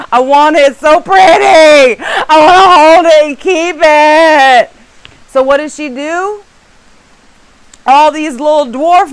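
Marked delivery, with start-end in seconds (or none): 0.1-4.7 hammed-up, loud whining wail; greedy craving. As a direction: cry out like you must have it. Acting out greedy craving for necklace.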